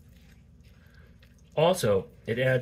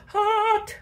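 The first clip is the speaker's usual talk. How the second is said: sharp yelp; 4 dB louder than usual. Real, not acted. Burnt fingers on hot meat.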